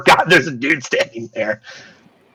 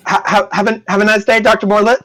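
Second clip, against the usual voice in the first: nervous stammering archivist; 8 semitones higher than usual. Acted. Nervous archivist says goodbye.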